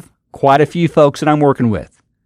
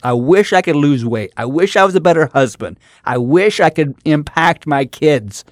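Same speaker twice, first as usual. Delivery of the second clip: ordinary talk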